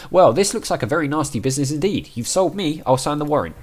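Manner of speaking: a lightly hammed judge granting it. Player voicing the judge as she signs the warrant.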